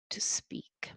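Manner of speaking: suspenseful whisper. Acted. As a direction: drop to a breath.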